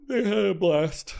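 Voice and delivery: mid-yawn speech. Real, not acted. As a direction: yawn through the words. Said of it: "Sorry for yawning again".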